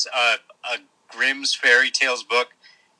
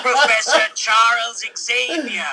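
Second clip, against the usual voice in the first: slow toy voice, laughing; talking doll with a laugh; pull string toy voice; 8 semitones higher, 3 dB louder, slower than usual. Pretend. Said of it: "let's try it".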